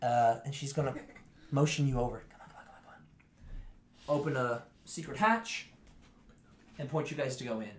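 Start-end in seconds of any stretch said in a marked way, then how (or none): 2.3-4.2 hushed, whispered beckoning; urgent whisper. Acted. Beckoning the party to a secret hatch, whispered. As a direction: whisper it in a quick string.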